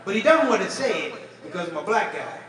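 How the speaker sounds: ordinary delivery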